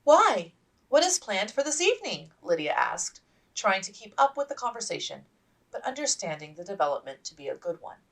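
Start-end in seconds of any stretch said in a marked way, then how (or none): 0.0-2.3 eager. Acted. Eager Lydia asks.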